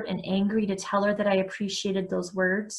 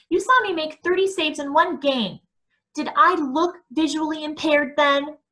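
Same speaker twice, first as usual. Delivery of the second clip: louder, pitched-up angry argument; heated protest; 6 semitones higher, 4 dB louder than usual. Acted. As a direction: push back hard at Mom. Son argues with mother.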